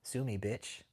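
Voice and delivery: quiet, low, dismissive "Sue me, bitch"; low defiant taunt. Retold or imitated. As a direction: toss it off quietly and coldly. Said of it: "You know what I tell 'em?".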